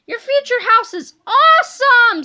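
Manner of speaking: high, loud admiration; thrilled. A narrator's reading. Boy admires his friend's future house, loudly thrilled. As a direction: gush at full voice.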